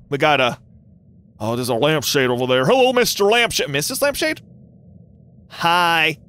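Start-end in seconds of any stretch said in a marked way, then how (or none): none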